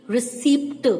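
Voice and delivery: "receipt" said as "re-seept", P sounded; common mispronunciation of receipt. Shown as a demonstration. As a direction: demonstrate the mistake, voicing the P in "receipt". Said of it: "most of the people speak". ("receipt" का गलत उच्चारण, "रिसीप्ट")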